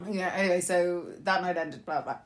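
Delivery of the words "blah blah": ordinary talk, nothing marked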